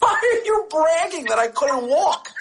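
high, shouted outraged protest; indignant child. Retold or imitated. The firstborn child yelling at the father about the bragging, in a high protest.

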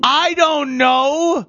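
high, long-drawn protest; playful helplessness. Pretend. Asked what he thought the seashell meant, playful helplessness.

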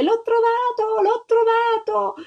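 high, drawn-out shout of triumph; joyful cry. A narrator's reading. Little girl finds a huge egg, joyful cry. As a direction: cry it out, wild with happiness. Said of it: "si mise a gridare".

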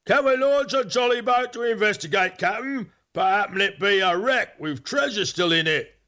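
high, excited call in a put-on sailor voice; eager crew shout. A narrator's reading. Crew begging the captain to launch a boat, high eager call. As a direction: plead eagerly up high, like keen deckhands.

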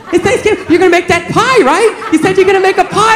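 high, eager girlfriend impression; excited girlfriend. Girlfriend waking him on Thanksgiving, mock excitement. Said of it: "she ran away all excited".

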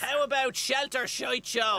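very high, slow silly suggestion; squeaky pitch. Acted. Minister proudly offering a crude slogan, high and slow. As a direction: stretch it out high and pleased.

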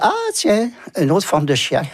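jumps way up in pitch on Ah, tiens; a bright mock discovery, pitched high; recalling a little eureka with a high-pitched ah; amused surprise. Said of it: "Alors j'ai dit".

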